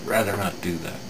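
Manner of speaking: ordinary delivery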